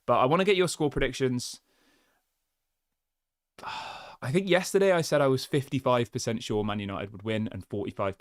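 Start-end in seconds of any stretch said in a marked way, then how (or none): 3.6-4.2 nervous sigh. Real, not acted. Nervous about the final.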